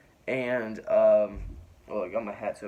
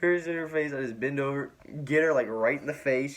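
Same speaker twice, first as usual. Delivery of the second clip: laughing retelling; 5 semitones higher, 5 dB louder than usual. Real, not acted. Laughing while retelling his blunder.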